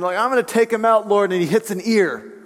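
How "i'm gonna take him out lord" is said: high-pitched, gung-ho mock bravado; Peter. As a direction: charge in as the overeager disciple. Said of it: "He's swinging as hard as he can".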